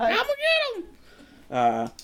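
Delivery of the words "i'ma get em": very high squeaky cartoon pup voice; Scrappy-Doo. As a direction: yap it out like an eager cartoon puppy. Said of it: "like fucking Scrappy-Doo over here".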